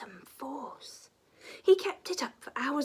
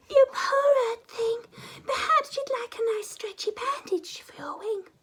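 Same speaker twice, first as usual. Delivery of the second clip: put-on high, whispery child's voice; gentle little girl; 12 semitones higher, 6 dB louder than usual. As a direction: soothe him like a kind little girl. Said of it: "She said".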